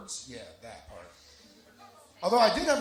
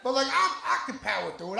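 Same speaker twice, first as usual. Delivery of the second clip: laughing; 5 semitones higher, 5 dB louder than usual. Real, not acted. Laughing exasperated complaint.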